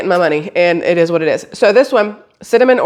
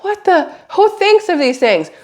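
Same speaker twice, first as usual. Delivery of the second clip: incredulous; 10 semitones higher than usual. Real, not acted. Baffled by tea product.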